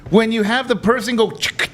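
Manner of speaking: ordinary delivery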